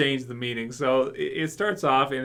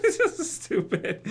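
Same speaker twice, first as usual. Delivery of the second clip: laughing; slower than usual. Real, not acted. Laughing at the dub.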